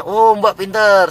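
high, loud, drawn-out mock praise; mock delighted praise. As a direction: gush slowly as if awarding a prize. Acted praise of the woman.

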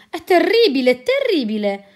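loud, high outraged exclamation; indignant cry. Acted. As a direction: burst out in indignation. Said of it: "esclamò indignato Diderot".

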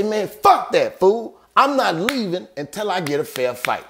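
high, heated retold comeback; defiant refusal. James refusing to leave without a fight, defiant and heated. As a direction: refuse hotly, pitched well up.

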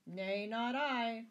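high, loud, drawn-out refusal; crying out. A narrator's reading. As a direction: cry a slow, emphatic refusal. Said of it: "cried the prior".

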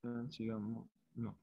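ordinary talk

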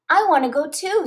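a small firefly begging to come along; little Lucy, eager. A narrator's reading. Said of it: "says Lucy".

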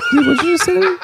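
squeaky laughing disbelief; laughing high outrage. Pretend. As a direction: shriek it through laughter. Mock outrage after laugh.

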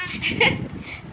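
breathy amused laugh; airy laugh. Real, not acted. Laughs at child.

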